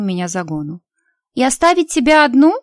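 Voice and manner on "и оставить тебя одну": put-on high, louder indignant girl; outraged at the very idea. A narrator's reading. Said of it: "возмутилась подруга".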